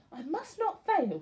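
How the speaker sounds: a touch of resolve; lightly voiced determined snail. A narrator's reading. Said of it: "said the tiny snail".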